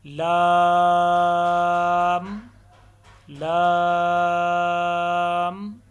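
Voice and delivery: لام held very long and loud, kept soft; long soft recitation. Shown as a demonstration. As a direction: sustain لام like a qari, light and even. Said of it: "کیسے پڑھنا ہے؟". (لام کو نرمی سے بہت لمبا کھینچ کر پڑھیں)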